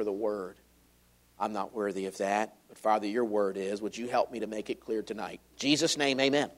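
pastor's quiet opening prayer. Acted. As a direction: pray humbly, hushed before the congregation. Leads prayer.

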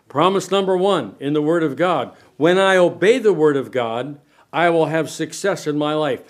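ordinary delivery

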